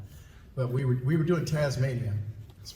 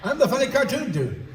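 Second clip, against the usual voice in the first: high, loud incredulous outburst; starstruck protest; 11 semitones higher, 6 dB louder than usual. Retold or imitated. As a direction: insist shrieking-high, half laughing. Retold starstruck protest to the actor.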